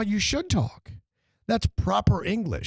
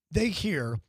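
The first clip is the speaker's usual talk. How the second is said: normal talking voice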